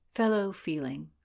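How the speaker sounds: plain reading voice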